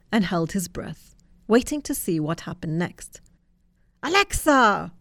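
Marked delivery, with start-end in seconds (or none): 4.0-4.9 roared call. A narrator's reading. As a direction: summon Alexa like a boss. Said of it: "roared the data giant".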